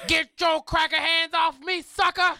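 high, loud comic shout; mock outraged yell. Co-host telling off the hair-touching stranger, mock outraged yell. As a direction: yell it as a joke, breaking into a laugh.

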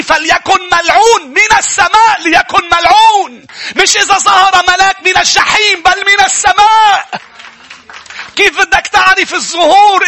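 high, loud fiery sermon voice; preaching at full force. Acted. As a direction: thunder the point at the congregation. (وعظ بحماس وبصوت عالٍ)

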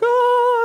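sustained high, loud, dreamy "ahh"; mock-blissful choir "ahh"; high angelic "ah". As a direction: sing out a heavenly held note. Mimics blissful start of marriage.

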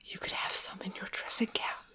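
soft breathy whisper in his ear. Acted. The valet whispering to Mister Saltina, hushed. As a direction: lean in and whisper it. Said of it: "bent and whispered".

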